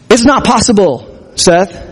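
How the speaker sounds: lightly voiced robot; a hint of a machine voice. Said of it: "in his robot voice".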